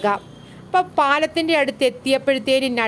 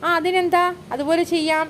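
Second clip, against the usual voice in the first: lightly mimicked reply, pitched up; a touch of playful imitation; mimicking younger brother; 6 semitones higher than usual. A narrator's reading. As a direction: echo the little brother in a higher pitch. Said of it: "നട്കഡ് കെ ശബ്ദോങ്കി നക്കൽ".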